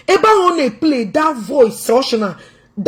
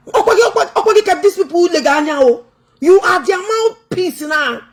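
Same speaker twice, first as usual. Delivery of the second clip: heated exhortation; 7 semitones higher, faster than usual. Real, not acted. Exhorting the officer to speak up.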